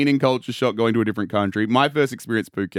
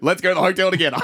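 normal talking voice

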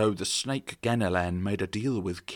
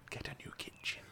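whisper; 15 dB quieter than usual. Acted. Emperor's aside, whispered.

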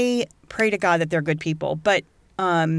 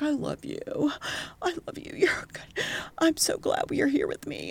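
soft, higher, near-whispered "I love you"; hushed, higher, tender imitation of a dad; 9 semitones higher, 7 dB quieter than usual. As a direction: coo it softly like a father hugging his son. Dad hugging son, tender words.

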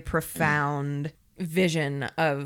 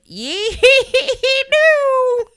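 cartoon miner voice; 9 dB louder, slower than usual. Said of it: "he's just like".

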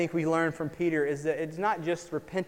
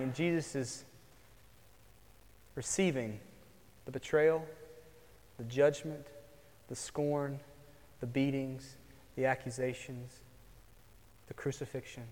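solemn hush; 5 semitones lower, 14 dB quieter than usual. Acted. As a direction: lay out each suffering quietly and gravely. Solemn list of Jesus' suffering.

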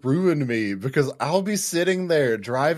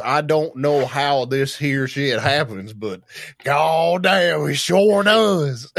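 put-on Southern farmer accent; 4 dB louder than usual. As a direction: play a new farm owner gone full Southern. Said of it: "then you talk like this".

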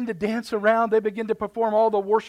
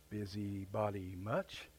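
dry aside; 11 semitones lower, 15 dB quieter, slower than usual. Real, not acted. Sarcastic aside about older brother.

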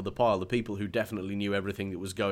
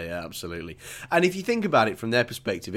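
ordinary talk, nothing marked

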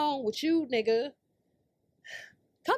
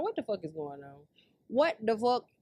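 ordinary talk, nothing marked

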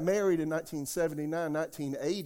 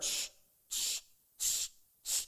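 a soft unvoiced shh, shh; quiet shushing; 6 dB quieter, slower than usual. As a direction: hush the room with breathy shushes. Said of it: "I heard".

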